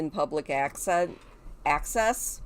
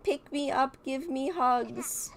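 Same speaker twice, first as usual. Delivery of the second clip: put-on high, slow, pleading little-kid voice; drawn-out whiny baby plea, pitched up; needy toddler voice; 9 semitones higher, slower than usual. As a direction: beg for cuddles like a clingy toddler. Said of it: "Oh, she's like".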